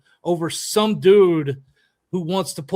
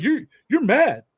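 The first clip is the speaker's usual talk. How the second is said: higher, louder imitated accusation; mocking the rival coach; 8 semitones higher, 5 dB louder than usual. Coach quotes rival accusing him of anger, mocking and high. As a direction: throw his taunt back in a raised, mocking voice. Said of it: "And he's like".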